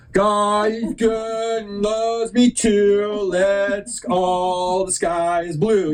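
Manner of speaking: high, very slow singsong recital; imitated child reciting. Kid reciting the thing for proud parents, mock slow high singsong. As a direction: drag it out like a kid reciting by rote. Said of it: "You know, like that".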